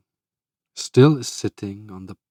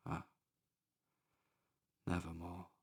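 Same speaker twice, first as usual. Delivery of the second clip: faded grief; 4 semitones lower, 23 dB quieter, faster than usual. A narrator's reading. Narrator mourns Lenore.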